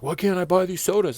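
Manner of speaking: mock irritated customer; a hint of an angry customer; annoyed customer.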